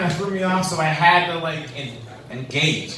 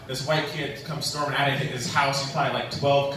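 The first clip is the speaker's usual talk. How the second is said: usual speaking voice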